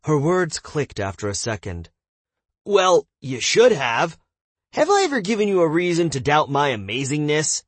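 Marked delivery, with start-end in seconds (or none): 2.6-7.6 boastful voice. Acted. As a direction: brag grandly. Cal boastful voice.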